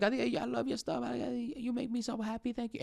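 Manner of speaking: high, gushing fan thanking him; squeaky high excited fan. Retold or imitated. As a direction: play an adoring fan gushing over his stuff. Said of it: "I'm always like, everybody see me".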